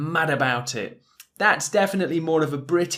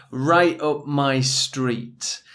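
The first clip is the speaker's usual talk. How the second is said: slow model phrase; much slower than usual. Shown as a demonstration. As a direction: say right up my street at half speed, each word clear.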